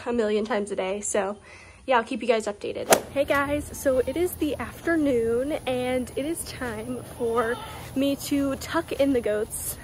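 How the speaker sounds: usual speaking voice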